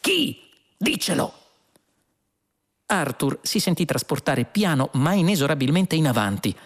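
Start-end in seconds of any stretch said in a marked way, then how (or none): 0.0-1.4 high-pitched eager outburst; urgent demand. A narrator's reading. Two men beg the computer for a name, urgent. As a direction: pounce on it, voice leaping high.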